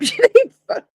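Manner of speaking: laughing. Real, not acted. Laughing at her own joke.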